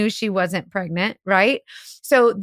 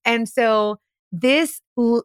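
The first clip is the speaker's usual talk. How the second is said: usual speaking voice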